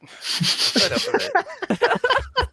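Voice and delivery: hammed-up falsetto complaint; mock whine. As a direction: stretch it out in a silly high whine. Mocking joke voice.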